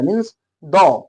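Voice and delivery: "द" drawn out slowly as a short duh; slow demo pronunciation. Shown as a demonstration. Teacher showing the right article sound, slow "द". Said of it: "द नहीं, द".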